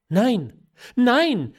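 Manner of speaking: two loud, high cries of protest; emphatic denial. A narrator's reading. Daughter insisting her feeling is true, emphatic denial. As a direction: deny it urgently, each word separate.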